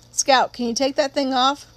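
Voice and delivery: usual speaking voice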